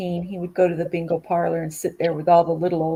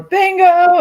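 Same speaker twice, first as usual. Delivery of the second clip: very high, loud yell; hollering kids; 12 semitones higher, 13 dB louder than usual. Retold or imitated. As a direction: shout it out like a prank. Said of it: "kids would walk by and holler".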